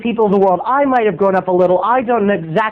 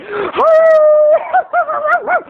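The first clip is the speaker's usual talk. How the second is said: imitated dog bark. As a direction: throw your head back and howl like a dog. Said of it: "did you know I can bark like a dog?".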